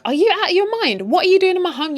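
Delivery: high, loud furious demand; wife yelling at the rival woman; outraged wife. Retold or imitated. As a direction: confront the intruder in her home. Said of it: "And Joyce is like".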